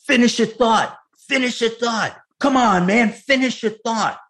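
high, loud, insistent imitation; Al Pacino. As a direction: urge an actor on, mimicking a forceful star. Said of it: "I hear Al Pacino on the other line going".

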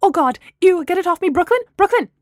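panicked. A narrator's reading. Panics over butt stuck on her.

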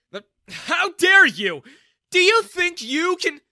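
very loud, high, slow outraged shout; furious outburst. A narrator's reading. Humiliated father shouts at the young man, furious. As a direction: explode in humiliated rage.

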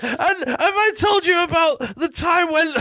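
high, drawn-out mock tirade; ranting at camera. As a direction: have a go in a strained high impression. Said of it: "starts having a go at the camera".

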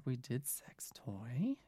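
whispered aside; breathy whisper. Real, not acted.

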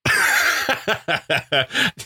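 laughing. Real, not acted. Host laughs at punchline.

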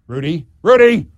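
loud bellow to call someone back; bellowing a name. Retold or imitated. Deputy mayor calling the wandering mayor back, bellowing. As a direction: call him back across the steps at full volume. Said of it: "she bellowed".

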